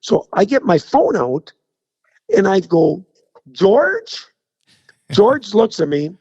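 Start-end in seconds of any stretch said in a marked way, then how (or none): 3.5-4.2 high, rising questioning call; mock star-struck call. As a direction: call out as if spotting a celebrity. Acting out greeting a supposed celebrity.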